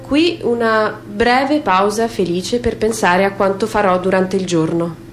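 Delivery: normal talking voice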